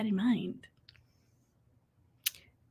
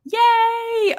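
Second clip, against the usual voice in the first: excited cheer; 13 semitones higher, 5 dB louder than usual. Real, not acted. Bursts out after the whisper.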